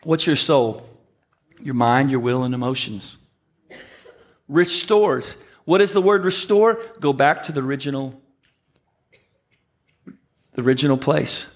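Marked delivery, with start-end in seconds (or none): none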